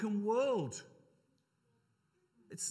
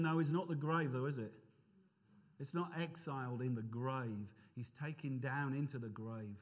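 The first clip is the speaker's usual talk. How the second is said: ordinary talk, nothing marked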